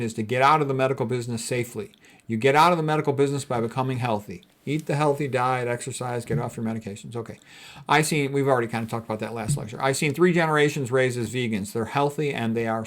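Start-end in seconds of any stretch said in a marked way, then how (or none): none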